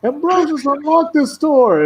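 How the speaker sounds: high squeaky shouting knight; furious Didymus. Retold or imitated. Didymus yelling at his hiding dog, squeaky and shrill. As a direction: yell for the cowering dog to come back. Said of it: "Didymus is like".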